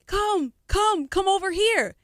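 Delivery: high-pitched, insistent calling over; eager labels beckoning. Retold or imitated. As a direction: imitate labels clamoring for an artist. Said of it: "the labels were like".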